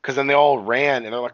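ordinary delivery

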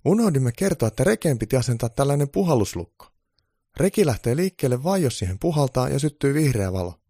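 high, loud elf voice; eager high-pitched little helper; elf explaining. A narrator's reading. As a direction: pipe up with instructions. Elf explains the forgotten lock.

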